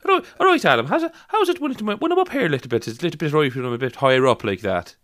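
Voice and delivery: high-pitched put-on Irish accent. Shown as a demonstration. Testing the accent on Adam, high Irish accent. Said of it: "with a bit higher up".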